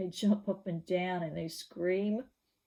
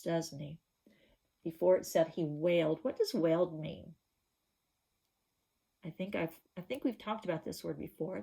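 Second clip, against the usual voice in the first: usual speaking voice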